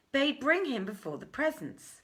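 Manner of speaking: plain reading voice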